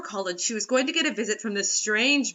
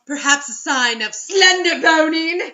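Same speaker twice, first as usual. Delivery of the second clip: slow, louder hammed-up doom voice; mock ominous narrator; 4 semitones higher, 9 dB louder, slower than usual. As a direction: deliver it like a cheesy trailer. Spooky mock-narration aside.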